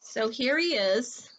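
ordinary delivery

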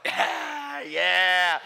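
strained yeah swelling into a loud one; forced thrill-seeker yeah; faked bravado. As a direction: cover your fear with a cheer. Caught grabbing, faking bravado.

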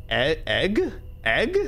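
"egg" with the short e of "edge"; unsure rising "egg", vowel as in "edge"; short-e pronunciation. Shown as a demonstration. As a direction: try out "egg" with a short e, questioning. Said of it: "like the e and edge".

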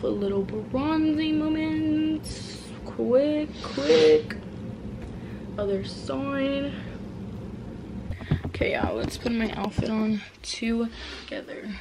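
soft unvoiced whisper, slow. Real, not acted. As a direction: hush it down to a whisper. Whispered outfit intro.

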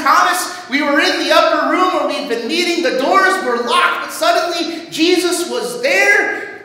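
high-pitched, excited eyewitness report; acted breathless-with-news urgency, pitched well up; urgent pleading. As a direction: plead with a doubting friend to believe astonishing news. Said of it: "pleading with him, urging him".

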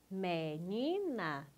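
"Menina" drawn out slowly in three separate syllables. Shown as a demonstration. Teacher confirming the pupil's split, syllable by syllable. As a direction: stretch each syllable of "Menina", rising on the middle one. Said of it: "separe as sílabas".

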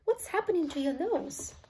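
high, rising alarmed question; mildly shrieked question. A narrator's reading. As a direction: ask in mounting shock. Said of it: "shrieked Missus Beauregard".

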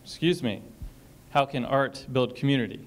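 ordinary delivery

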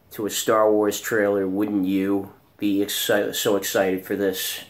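usual speaking voice

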